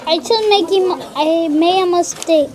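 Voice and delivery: loud, high upset protest. Real, not acted. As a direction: complain loudly about the mistake. Child upset over writing mistake.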